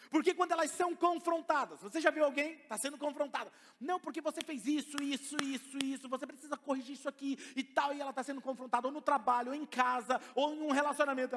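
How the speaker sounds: high, loud pulpit voice; fiery preaching. Acted. Preacher rousing the congregation, loud and high. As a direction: drive the point home to the church.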